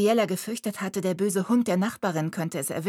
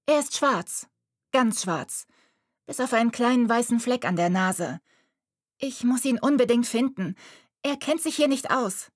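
put-on young girl; 6 semitones higher than usual. A narrator's reading. Girl explains to Mariella her kitten is a baby. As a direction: speak as the worried prim girl.

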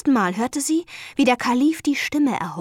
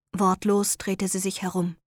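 ordinary delivery

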